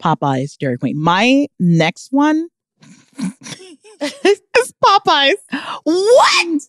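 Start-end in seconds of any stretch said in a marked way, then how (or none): 4.0-6.6 high, slow, stunned disbelief; shocked. Real, not acted. Shocked that Popeyes beat McDonald's. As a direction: sound shocked at the ranking.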